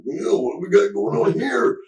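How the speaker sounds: very high put-on wrestler voice; Randy Savage. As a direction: caricature a wrestler sizing up the scene. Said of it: "He looks at me, and he looks at the cops".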